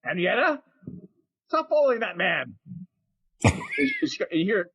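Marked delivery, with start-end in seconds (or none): 0.0-2.6 high-pitched scolding woman; wife calling her pig. Acted. Woman at the front door, calling after her pig. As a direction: call after the pig in a raised voice. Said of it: "Martha at the front door going".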